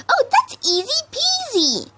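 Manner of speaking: a giddy pony dreaming of parties; bubbly Pinkie voice. A narrator's reading.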